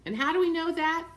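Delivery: ordinary delivery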